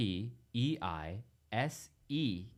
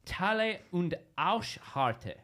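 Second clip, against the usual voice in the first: slow German lyric reading, a bit louder; German accent; 7 semitones higher, 4 dB louder, slower than usual. Acted. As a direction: read the lyric slowly in German. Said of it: "read you some words in my German accent".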